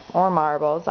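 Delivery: normal talking voice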